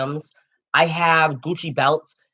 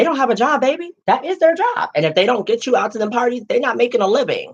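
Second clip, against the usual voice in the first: sassy high voice; 8 semitones higher than usual. Acted. Sassy aside about promoters.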